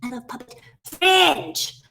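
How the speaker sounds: excited shout landing on "fringe"; stress on FRINGE. Acted. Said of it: "what's the most exciting thing".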